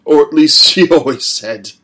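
put-on defiant young captive; boy's defiant jibe. A narrator's reading. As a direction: spit the insult back. Boy taunts father.

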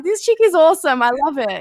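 high-pitched enthusiastic approval; retold admiration. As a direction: recall being delighted by someone, pitch lifted. Retells admiring thought about the mother.

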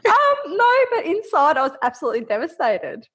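ordinary delivery

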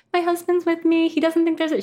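high-pitched mom voice; imitating her mom. Quoting her mom's reaction to a friend, high mock mom voice. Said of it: "I was telling my mom".

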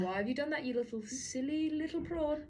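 mock self-scolding. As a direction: gently tell yourself off in the mirror. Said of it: "catch yourself in the mirror and you go".

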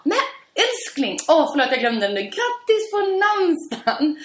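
high, slow, syrupy sweet; sugary high apology. Retold or imitated. Wife coos a belated happy name day to her husband, high and slow. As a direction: coo it at him like a doting wife, drawn out.

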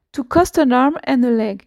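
English spoken with a French accent; careful English, French accent. Real, not acted. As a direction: say it in English as a French speaker would. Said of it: "j'ai un magnifique accent, euh, anglais".

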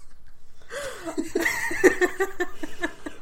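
long, high laughing fit. Real, not acted. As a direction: laugh helplessly after venting. Laughs after venting.

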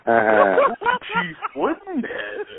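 high, very drawn-out name through laughter; comic high slow-motion character call; Chief Woodenhead impression. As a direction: stretch each word out high, breaking into laughs. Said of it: "gonna do my best old Chief Woodenhead impersonation".

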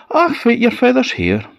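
high mother's voice; a Scots mother reassuring. A narrator's reading. Mother, far timbre.

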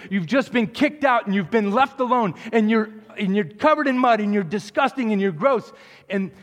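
retold fiery sermon shout; booming preacher yell. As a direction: preach it at full force to the crowd. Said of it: "he's got this booming voice".

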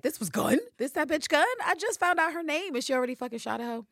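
high, loud outraged squeal; outraged shout. Retold or imitated. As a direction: shriek it in disbelief at being shot. Villanelle outraged at being shot.

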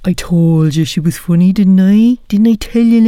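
loud to listeners. Real, not acted. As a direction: crow to the audience about her. Host addressing audience after call.

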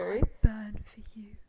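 whispered romantic line; breathy whisper. Retold or imitated. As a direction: whisper it like a lovesick hero. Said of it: "Daphne and Bridgerton".

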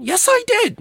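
very high, squawked comeback; hammed-up shrieky insistence; mock shouted bickering. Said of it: "just a shouting back and forth".

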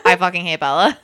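deadpan pretend show intro; mock announcer. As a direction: announce it slowly like a host.

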